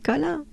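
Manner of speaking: Colin as co-LAN with nasal ending; French pronunciation. Shown as a demonstration. Teacher showing how we pronounce it, French Colin. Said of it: "but we pronounce it".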